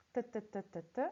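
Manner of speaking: short crisp "T, T, T, T, T" like little trotting boots; repeated T sound. Shown as a demonstration. Teacher imitating little boots trotting, crisp repeated "T". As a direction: tap out each "T" briefly, one after another. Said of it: "c'est le son T qui se répète".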